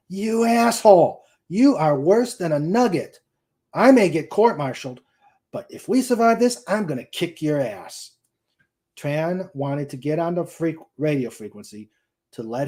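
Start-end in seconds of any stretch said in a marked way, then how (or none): 0.1-8.1 angry outburst, pitched higher and louder; growled. A narrator's reading. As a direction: spit it out at the idiot flight lead, furious. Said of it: "Tran growled to himself".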